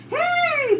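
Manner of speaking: sharp high loud "Hey!"; calling out. Retold or imitated. Yard duty calling to the group of girls, high loud call. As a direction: yell to get the girls' attention.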